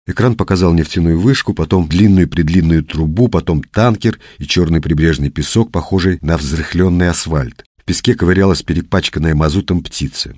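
ordinary delivery, nothing marked

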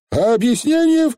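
высокий голосок, неловкий переспрос; тяни время, переспроси высоко; растерянно переспрашивает. A narrator's reading. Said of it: "переспросил он, переступая с ноги на ноги". (растерянный переспрос)

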